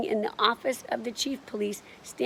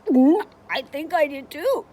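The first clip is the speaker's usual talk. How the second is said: raised, louder, quivery thief; 7 semitones higher, 7 dB louder than usual. A narrator's reading. Frightened thief answers his partner about the yelling picture, quivery. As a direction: quaver it in a higher voice. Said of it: "in a very quivery voice".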